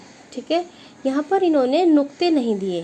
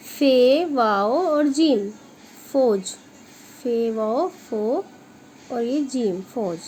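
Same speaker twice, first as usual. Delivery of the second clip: slow spelling out; much slower than usual. Shown as a demonstration. Teacher spelling out letters for pupils, slowly. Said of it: "छोटा छोटा लिखेंगे आप".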